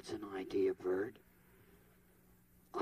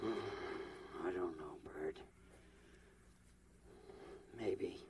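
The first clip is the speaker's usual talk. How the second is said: weary whisper; 6 semitones lower, 10 dB quieter, much slower than usual. Acted. Sighing, weary from hiccups.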